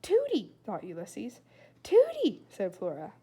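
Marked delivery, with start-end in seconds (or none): 0.0-0.5 excited thought. A narrator's reading. Squirrel thinks name excitedly. 1.8-2.5 high, loud happy cry; excited greeting. A narrator's reading. Flora greeting the neighbour at the door, thrilled.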